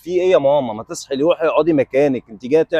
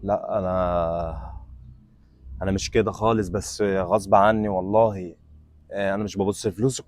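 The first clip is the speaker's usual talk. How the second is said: much lower, softer, slow plea; pleading; 9 semitones lower, 8 dB quieter than usual. Acted. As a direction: beg forgiveness, deflated. Man begging forgiveness.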